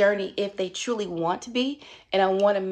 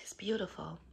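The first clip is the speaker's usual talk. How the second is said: whisper; 12 dB quieter than usual. Real, not acted. Moved, whispers softly.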